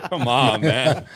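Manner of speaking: ordinary delivery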